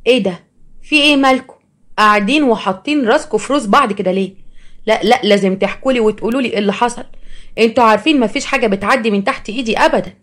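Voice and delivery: pushy storybook sister; nosy, demanding sister. Acted. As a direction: burst in demanding to know everything. Narrator voices nosy sister walking in.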